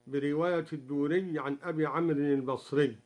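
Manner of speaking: ordinary delivery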